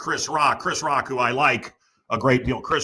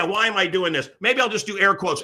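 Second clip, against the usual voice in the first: normal talking voice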